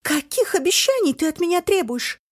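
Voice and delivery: loud, high, baffled cry; bewildered shout. A narrator's reading. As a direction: shout it in confusion. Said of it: "в недоумении закричала мать".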